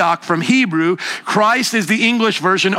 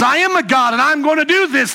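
higher-pitched, mocking divine retort; sassy comeback; 6 semitones higher than usual. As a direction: throw the taunt right back with sass. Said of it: "he brings that sass right back".

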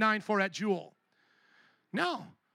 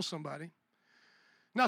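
ordinary talk, nothing marked

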